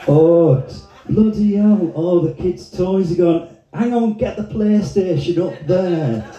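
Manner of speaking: high-pitched, flustered Sheffield local; Sheffield accent. Acted. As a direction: fret like a flooded-out Sheffield resident. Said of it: "some poor sod in Sheffield going".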